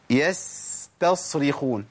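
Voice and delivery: very slow يستصرخون, with the س and ص kept clearly apart; careful s and sad. Shown as a demonstration. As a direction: spell out يستصرخون, light س then heavy ص. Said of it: "ده جالي سين وصاد".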